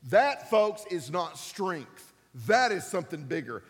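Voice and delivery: normal talking voice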